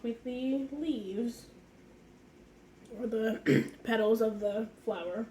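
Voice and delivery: hoarse voice from a cold; raspy, worn-out cold voice; smoker's rasp. Real, not acted. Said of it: "if I sound like I've been smoking for about twenty-five years".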